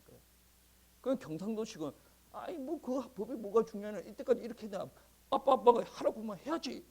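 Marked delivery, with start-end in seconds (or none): 2.3-6.8 higher, mock insistent dad, louder at the end; pushy Gyeongsang elder. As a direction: bulldoze it like a stubborn father. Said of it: "막 이런 식으로 밀어붙여". (밀어붙이는 경상도 아버지 흉내)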